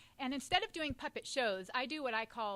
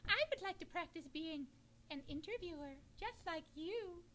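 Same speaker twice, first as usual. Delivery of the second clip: Featherly puppet voice; 6 semitones higher, 5 dB quieter than usual. Acted. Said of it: "my name is Featherly".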